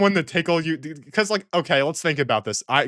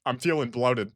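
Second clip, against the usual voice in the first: mocking needy texter; 6 semitones lower, 6 dB quieter than usual. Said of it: "why would you be like".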